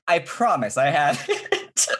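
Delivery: high, drawn-out mock insistence breaking into laughter; improv-style pleading. Flustered driver assuring cop about license, hammy high pleading with a laugh. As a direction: plead slowly and too theatrically, then crack up. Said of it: "why I acted like that".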